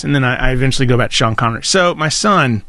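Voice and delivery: usual speaking voice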